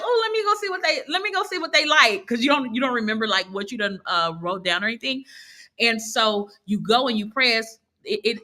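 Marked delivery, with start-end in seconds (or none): 0.0-2.3 high, eager imitation of a user; eager curiosity. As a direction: act out sudden nosy interest, pitched up. Voices a curious user seeing notification.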